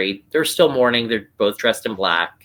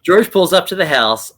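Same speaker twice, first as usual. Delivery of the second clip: George pulled out louder and higher; louder restart after a flub; emphatic restart; 6 semitones higher, 5 dB louder than usual. Real, not acted. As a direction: restart the line loud and clear, stressing George. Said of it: "maybe this can be edited out".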